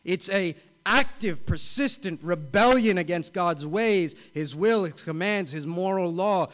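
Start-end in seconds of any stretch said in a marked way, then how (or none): none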